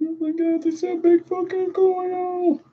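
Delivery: very high squeaky astonishment; hammed-up high-pitched awe; mock amazement. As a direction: gasp it out in a silly high voice. Exaggerated amazement at a coil.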